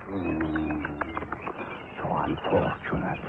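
soft, low sigh of feigned regret; mock sorrow. Sailor pretending grief over the sinking, softly sighing. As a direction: breathe it out sorrowfully.

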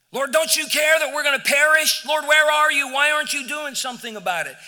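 loud, high, frantic cry to the Lord; terrified disciples crying out; desperate shout in the storm; panicked plea. Acted.

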